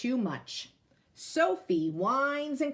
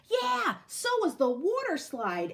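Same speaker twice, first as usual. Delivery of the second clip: put-on higher, eager girl's voice; a lightly voiced excited girl; Fiona voiced; 8 semitones higher than usual. A narrator's reading. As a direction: chime in brightly as a young girl. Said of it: "adds Fiona".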